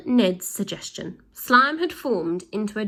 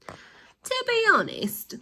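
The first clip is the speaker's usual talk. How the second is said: high, slow put-on villain voice; Mister G voice; much slower than usual. Villain husband starts a mock apology, high and slow. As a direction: stretch it out in a high silly voice. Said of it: "began Mister G".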